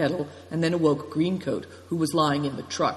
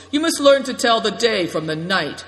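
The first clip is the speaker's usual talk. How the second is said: loud, raised, scolding tone; acted irritable telling-off; cross shout; 6 semitones higher, 6 dB louder than usual. A narrator's reading. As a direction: scold them crossly at volume. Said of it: "he shouted over crossly".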